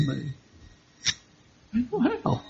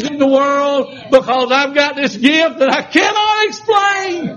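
preaching from level to a high shout; building sermon; 7 semitones higher than usual. Acted. Preacher thanking God for the unspeakable gift, rising to a shout. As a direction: climb to a full-throated shout on the gift.

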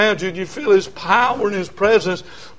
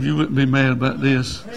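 ordinary delivery

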